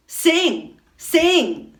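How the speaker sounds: high, loud mocking taunt; jeering. Queen jeers at the swans before their death, loud and high. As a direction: jeer it up at them. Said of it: "she jeered".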